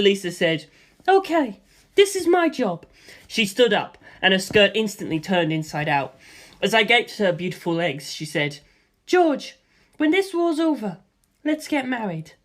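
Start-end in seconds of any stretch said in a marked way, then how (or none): 1.1-2.8 much higher female voice, matter-of-fact; higher woman's voice, composed; calm woman. Acted. Lisa Lisa voiced. 9.1-12.3 higher woman's voice, gentle; woman's proposal. Acted. Lisa Lisa proposing.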